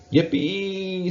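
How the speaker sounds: high, loud gleeful squeal; squealing yippee. A narrator's reading. Mouse squealing with glee alone in the classroom. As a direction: squeal with joy. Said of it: "squealed Morris".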